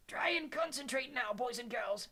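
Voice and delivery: put-on high, slow, gently coaxing old-teacher voice; soft-spoken professor. A narrator's reading. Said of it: "he said softly".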